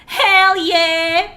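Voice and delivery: loud, high "Hell yeah!"; excited cheer. Real, not acted. Streamer cheers at the game's news, a loud excited whoop.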